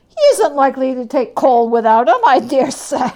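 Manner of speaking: a callous thief laughing it off; loud mocking old woman; brazen charwoman. Charwoman brags about her theft.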